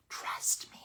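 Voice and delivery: eerie whisper; spooky hushed repeat. Real, not acted. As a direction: whisper it like a ghost story. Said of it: "that was very spooky".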